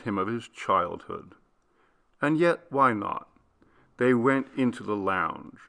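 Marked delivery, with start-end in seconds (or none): none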